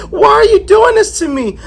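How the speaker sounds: high-pitched, hammed-up plea; distressed protest. Ro and ghost quarrelling, high wounded protest. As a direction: pitch leaps up in mock anguish.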